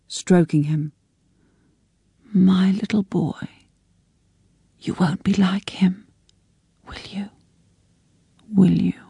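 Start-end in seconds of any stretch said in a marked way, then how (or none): 2.3-9.0 whispered, tear-soaked pleading; tearful whisper. A narrator's reading. Mother cuddling her son, whispering through tears. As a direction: whisper it to the child, begging. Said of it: "her face wet with tears".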